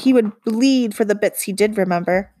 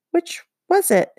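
puzzled; 9 semitones higher than usual. A narrator's reading. Goblin confused over identical babies.

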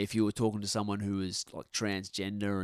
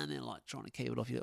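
normal talking voice

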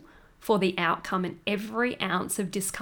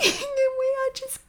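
laughing. Real, not acted. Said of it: "cacking our pants laughing".

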